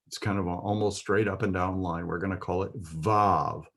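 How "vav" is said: "Vav" with a V as in victory; V rather than W at the start of "Vav"; V pronunciation. Shown as a demonstration. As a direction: say "Vav" starting on a clear V sound. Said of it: "it has the V sound as in victory".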